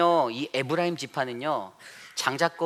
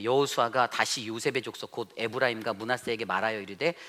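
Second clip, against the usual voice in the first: ordinary delivery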